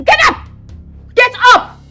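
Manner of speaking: sharp, high-pitched angry order; angry command. Acted. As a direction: order them up in a fury. Contemptuous order in an acted quarrel.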